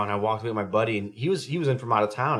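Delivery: ordinary delivery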